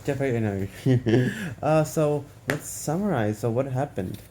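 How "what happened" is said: ordinary delivery, nothing marked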